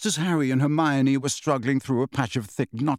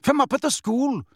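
high-pitched, scornful accusation; contemptuous; 9 semitones higher than usual. A narrator's reading. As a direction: sneer it high at the leader. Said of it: "interrupted Bane contemptuously".

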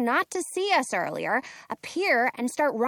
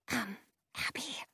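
breathy hushed whisper; 12 dB quieter than usual. Acted. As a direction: whisper it nervously. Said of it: "Penny whispers at me".

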